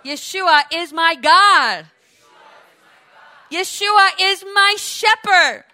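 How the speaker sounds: slow, loud, rising proclamation; congregational declaration. Shown as a demonstration. Preacher leading the flock to say with him, slow declaration. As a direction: lead the crowd line by line, drawn out. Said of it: "let's say, say with me".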